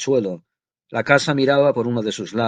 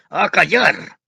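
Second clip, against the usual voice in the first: shout; 6 semitones higher, 5 dB louder, much slower than usual. A narrator's reading. Father silencing his wife with a loud, raised shout. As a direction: bark at the wife to shut up. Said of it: "le gritó Siriayev".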